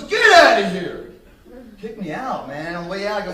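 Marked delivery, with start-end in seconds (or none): 0.0-1.1 kicked out. Retold or imitated. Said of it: "he goes".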